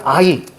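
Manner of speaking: "아이" with the i glide at the back. Shown as a demonstration. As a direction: say "아이" as a diphthong ending in i. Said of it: "옛날에는". ('아이'를 뒤에 이 소리가 오게)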